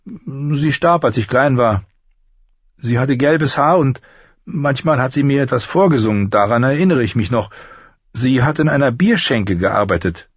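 high-voiced lad remembering; apprentice boy recalling his mother.

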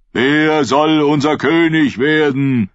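high, loud, slow sermon to the crowd; preaching prophet. Acted. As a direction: proclaim it like a fervent prophet. (Prophet predigt laut und langsam zu seiner Schule)

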